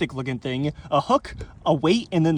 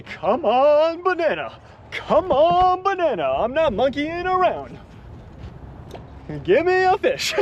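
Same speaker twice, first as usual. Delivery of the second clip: pleading; 7 semitones higher, slower than usual. Real, not acted. Begging the bait for a bite.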